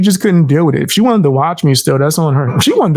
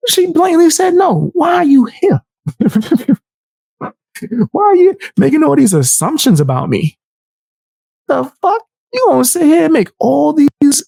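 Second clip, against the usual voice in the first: incredulous rant; 11 semitones higher than usual. Real, not acted. Ranting at absent caller.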